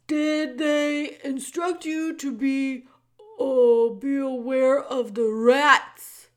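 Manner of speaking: put-on loud, booming old jailer who speaks of himself by name; the jailer Gregory greeting Meg in a big theatrical voice. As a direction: boom it out like a grand old jailer. Gregory warns.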